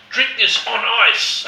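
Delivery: high, loud, slow ad-voice line; mock ad slogan. Quoting the old Yellow Glen ad, hammed-up. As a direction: sell it like a cheesy commercial.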